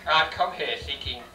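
normal talking voice

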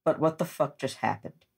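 ordinary talk, nothing marked

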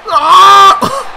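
loud, high angry yell; frustrated scream. Real, not acted. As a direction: let out a furious shriek. Frustration outburst.

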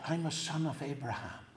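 soft, low retold confession; conspiratorial hush. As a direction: confide it quietly, like a secret in the retelling. His secret revealed in whispered exchange.

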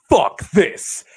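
imitated high, loud shout of disgust; angry outburst. Fan reacting to the pinfall, furious imitated shout. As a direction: act out a fan exploding. Acting out fan's instant outburst.

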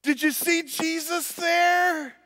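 put-on very high, slow, loud little girl question. Four-year-old daughter asking dad, very high and loud. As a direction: ask it with total wide-eyed innocence. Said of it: "She said".

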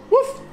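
put-on squeaky little dog woof for a toddler; high dog bark woef. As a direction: yip it like a tiny puppy. Dad imitating dog for toddler.

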